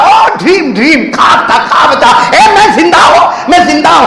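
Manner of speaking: loud, high cry of relief; hammed-up wife's gleeful shout; joyful relief. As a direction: shout with joy that you're alive. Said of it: "اور کہا".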